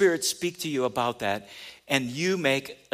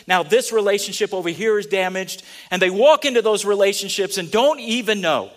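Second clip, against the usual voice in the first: loud, driving sermon build; preaching at full force; 5 semitones higher, 8 dB louder than usual. Acted.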